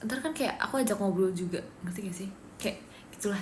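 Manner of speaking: unvoiced whisper, words trailing off; whispered aside. Real, not acted. Streamer whispering confidingly to her viewers about chatting. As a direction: lean in and whisper it like a secret.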